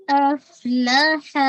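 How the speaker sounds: slow افلاح with a breathy whisper of air on the ف and the ح; tajweed demo. Shown as a demonstration. Teacher models افلاح for her students, slow and breathy. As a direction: recite افلاح slowly, letting the air out on ف and ح. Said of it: "ہمس ہوتا ہے".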